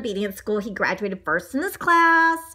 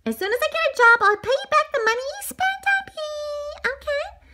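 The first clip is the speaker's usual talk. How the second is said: high, hammed-up weepy squeak; mock sobbing, squeaking up high and fading at the end; tearful promise. Tearful donut promises to repay.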